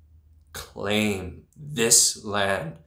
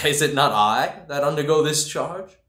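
derisive laugh; 6 semitones higher, 3 dB louder than usual. Acted. Laugh then indignant.

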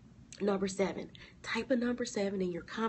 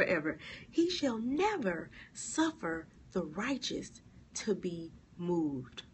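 normal talking voice

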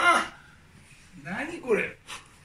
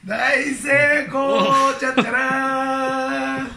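prank reveal shout; 5 semitones higher, much slower than usual. Acted.

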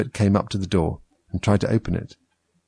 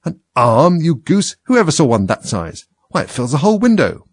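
loud, higher-pitched rebuke; exasperated, raised character voice; cross scolding; 7 semitones higher, 5 dB louder than usual. A narrator's reading. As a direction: scold a foolish servant. Rabbit scolds his servant.

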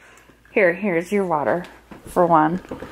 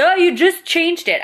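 lightly voiced exasperated husband, higher; imitating husband; 8 semitones higher than usual. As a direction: play the husband's mild exasperation. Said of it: "He'd be like".

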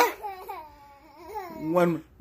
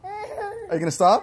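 high playful; 11 semitones higher, 5 dB quieter than usual. Real, not acted. High playful repeat of the question.

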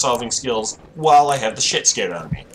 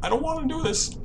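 scared whimper; 13 semitones higher, 5 dB quieter than usual. Real, not acted.

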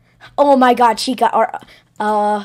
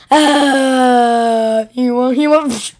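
normal talking voice

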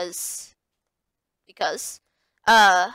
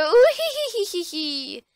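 high sly spider giggle; villainous giggle; 9 semitones higher than usual. Acted. Muffet laughs after dialogue.

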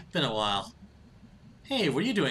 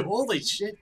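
ordinary talk

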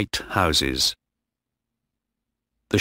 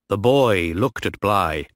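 ordinary delivery

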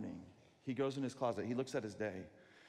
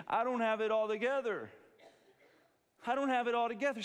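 higher, louder pleading cry; desperate confession; 8 semitones higher, 7 dB louder than usual. Pretend. Sinner crying out to Jesus, loud and high. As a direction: cry it out to God. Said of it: "come to him and say".

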